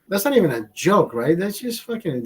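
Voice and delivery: ordinary talk, nothing marked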